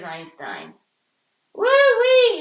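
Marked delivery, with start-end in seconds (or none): 1.5-2.4 high, loud whooping cheer; excited cheer. Real, not acted. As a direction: whoop it up for the new year. Cheering at New Year party.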